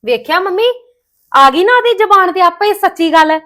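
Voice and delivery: normal talking voice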